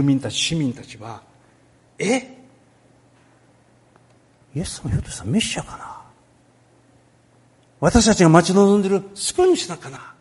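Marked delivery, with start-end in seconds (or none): 2.0-10.1 a hint of puzzled crowd voices, rising; lightly voiced Jerusalem residents wondering; wondering townspeople. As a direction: muse aloud like unsure townsfolk. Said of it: "と考え始めた".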